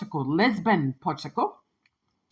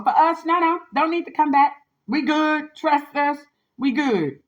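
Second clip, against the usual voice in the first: dismissive; 7 semitones higher than usual. Real, not acted. Dismissing men who come back.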